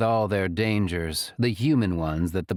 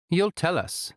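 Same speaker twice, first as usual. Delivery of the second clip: put-on Arkelian accent; 9 semitones higher than usual. A narrator's reading. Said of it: "she says in an Arkelian accent".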